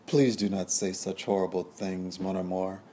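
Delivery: ordinary delivery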